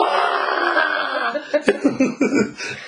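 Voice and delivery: cracks up laughing at the story; big high laugh. Real, not acted.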